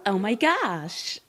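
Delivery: put-on slow, stretched-out Valley Girl accent; mock Valley Girl drawl. Shown as a demonstration. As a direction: drag it out in Valley Girl speak. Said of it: "probably the Valley Girl accent".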